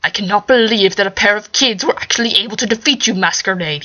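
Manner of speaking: ordinary delivery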